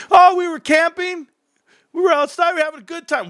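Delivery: put-on cheerful kid voice. Kid telling dad about mosquito trip, high cheerful voice. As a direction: sound blissfully unaware.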